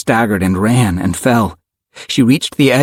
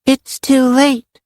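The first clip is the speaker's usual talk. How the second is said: put-on high, slow little-girl voice; simply stated; slower than usual. A narrator's reading. Little girl Karen tells the arriving friends, simply. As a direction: say it simply, as a child. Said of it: "she said simply".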